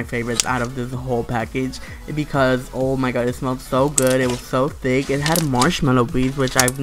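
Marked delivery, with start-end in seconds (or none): none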